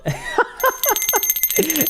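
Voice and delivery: very high delighted laugh; giddy high laugh. Real, not acted. Streamer celebrating a viewer's win, high giddy laugh. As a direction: burst into a squealing laugh.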